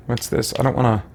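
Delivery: ordinary talk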